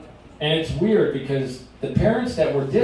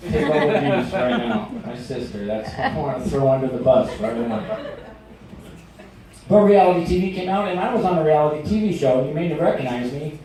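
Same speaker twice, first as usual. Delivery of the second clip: normal talking voice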